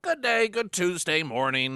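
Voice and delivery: big morning-radio hello, projected; radio show opener. Acted. Radio host greeting listeners on Tuesday morning, loud announcer delivery.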